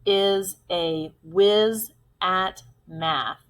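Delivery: slow, separated words; deliberate classroom dictation. Shown as a demonstration. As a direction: pause after every word. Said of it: "let's say our sentence again".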